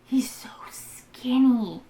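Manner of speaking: he's so skinny, slow hushed whisper. Real, not acted. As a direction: breathe it out slowly. Whispered agreement.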